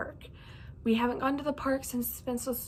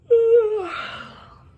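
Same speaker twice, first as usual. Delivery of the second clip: a long, loud real yawn, rising high; big yawn; 13 semitones higher, 12 dB louder than usual. Real, not acted. Vlogger yawns during her book update, a big loud yawn. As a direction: let a big sleepy yawn out mid-sentence. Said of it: "sorry for that little yawn".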